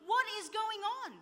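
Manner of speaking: high-pitched, baffled appeal; exasperated bewilderment. Retold or imitated. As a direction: cry out for an explanation, voice lifted. Bewildered cry to God, nothing explains it.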